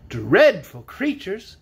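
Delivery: slow, drawn-out dismay; exclaiming. A narrator's reading. Woman hearing of the Mangaboos, exclaiming. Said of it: "exclaimed the woman's voice".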